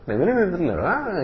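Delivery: hammed-up me duele; mock pain. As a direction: complain theatrically of pain. Acting pain of the patient.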